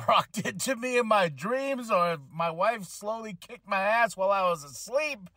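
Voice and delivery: ordinary talk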